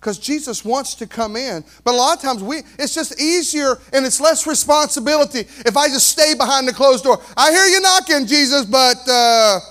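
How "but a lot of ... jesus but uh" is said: rising preaching. Acted. Preacher builds then voices excuse.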